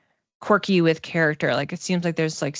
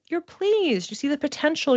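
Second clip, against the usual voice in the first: ordinary talk, nothing marked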